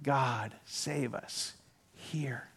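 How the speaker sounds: very soft, low prayer; barely voiced, spaced-out cry for help; hushed plea. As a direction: let it sink away in exhausted prayer. Hushed prayer at wits' end.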